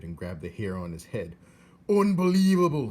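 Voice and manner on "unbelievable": high, loud shout of disbelief. Acted. Uncle shouting at the soldier in disbelief. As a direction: blow up at the news. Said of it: "he shouted".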